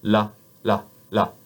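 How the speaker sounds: "la" with the tongue tip on the top teeth; light clear French "la". Shown as a demonstration. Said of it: "one more time".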